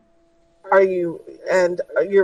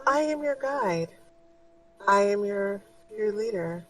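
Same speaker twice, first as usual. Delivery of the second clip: soft, much quieter motherly voice; gentle spirit guide; 2 semitones higher, 6 dB quieter than usual. Acted. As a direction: reassure her tenderly. Guide speaks.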